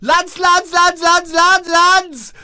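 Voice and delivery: high, loud excited calling out. Acted. Debbie bursting in on the lads, high excited calling. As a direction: call the lads, bursting with news.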